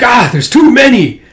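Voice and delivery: high, loud alarm; panicked shout. Acted. Warlord overwhelmed by attackers, panicked yell. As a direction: cry out as they swarm in. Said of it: "He's like".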